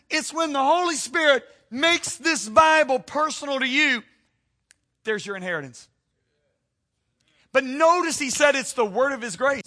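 loud, high, driving sermon climax; fiery preacher voice; preaching at full force. Acted. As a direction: hammer it home to the congregation. Preaching rises again.

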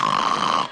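rough, noisy imitated snore; passed-out snore. Passenger comatose after the pills, imitated snore. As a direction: mimic someone out cold, drooling. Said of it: "like drool coming out".

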